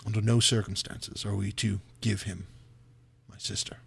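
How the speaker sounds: low, subdued plea with weight; grave and hushed, lightly acted; heavy, sighing. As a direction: say it softly, as after a heavy sigh. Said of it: "He kind of sighs heavily and he says".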